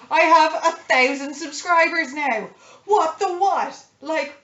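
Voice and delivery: high, loud disbelief; excited. Real, not acted. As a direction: burst out in real delight. Announcing a thousand subscribers.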